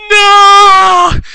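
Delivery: high, loud, drawn-out "no"; scream. Acted. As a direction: wail it in hammy horror. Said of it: "Seafrep and Raymond scream".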